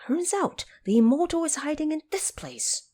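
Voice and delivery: high, eager discovery; the monkey lights up at finding the hermit; delighted Monkey King. Acted. Monkey King overjoyed to find the immortal's hiding place.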